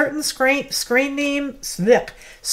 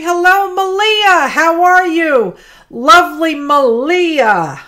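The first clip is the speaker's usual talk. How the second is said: bright, loud, much higher welcome to a viewer; cheery greeting; 7 semitones higher, 9 dB louder than usual. Real, not acted. Greeting a viewer arriving in chat, loud and cheerful. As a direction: call out a happy hello.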